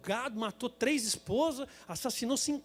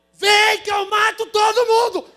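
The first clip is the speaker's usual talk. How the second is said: slow, roaring threat, much higher and louder; furious shout; 10 semitones higher, 15 dB louder, slower than usual. Retold or imitated. Leaning out the window threatening the squeegee men, furious roar. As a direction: yell the threat out the car window. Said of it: "E eu saí de novo pela janela".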